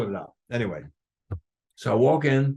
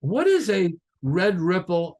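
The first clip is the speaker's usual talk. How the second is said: ordinary talk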